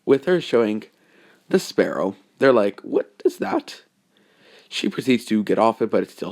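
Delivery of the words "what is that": high breathless gasp of a question; surprised. Acted. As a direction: ask it in hushed disbelief. Shocked friends react to sparrow.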